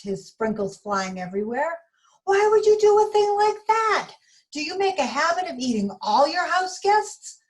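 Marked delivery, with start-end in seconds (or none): 2.2-7.4 outraged. Acted. Donut outraged at being eaten.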